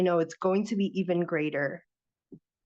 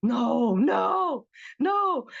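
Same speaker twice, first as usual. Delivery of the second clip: overwhelmed disbelief; 4 semitones higher, much slower than usual. Retold or imitated. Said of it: "and I'm like".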